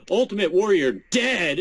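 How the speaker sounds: imitating her, "dead" punched high; mock obnoxious stress on "dead"; obnoxious emphasis. Host mimicking the news anchor's delivery, obnoxious emphasis on "dead". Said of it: "obnoxious emphasis on the word".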